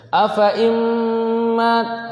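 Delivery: slow, high chanted AFA IMMAT, sounds held; long recited vowel. Shown as a demonstration. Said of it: "teksnya ia itu dibaca panjang".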